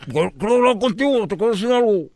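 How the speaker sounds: garbled, closed-mouth mumbling; muffled, insistent ventriloquist voice. Acted. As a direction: force words out through a tied-up muzzle. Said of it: "era como ventríloco".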